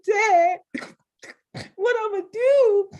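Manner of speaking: high, loud wailing plea to dad; mock crying plea. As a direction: wail it like a sobbing daughter, half-joking. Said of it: "I'm crying hard".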